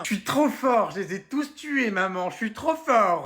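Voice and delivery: high, drawn-out crowing; triumphant boasting. Acted. Son bragging to mother about his game, triumphant. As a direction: boast like an overexcited young gamer.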